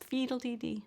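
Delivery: put-on lisping like a little kid; high little two-year-old lisp; toddler lisp. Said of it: "this little two-year-old accent. It's like a lisp".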